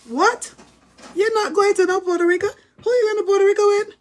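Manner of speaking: high, loud, fast outraged mother; mother hearing her teen bought a ticket, shouting in shock; shocked mom yelling. Retold or imitated. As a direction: retell mom shouting in disbelief. Mother hears of solo trip.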